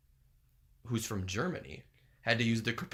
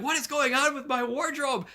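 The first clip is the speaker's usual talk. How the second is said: very high, theatrical dismay; hammed-up panic, pitched way up; mock alarm; 13 semitones higher than usual. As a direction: squeal it as a comic figure in trouble. Voicing Gene stuck in window.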